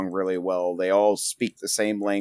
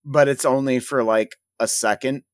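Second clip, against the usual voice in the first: ordinary talk, nothing marked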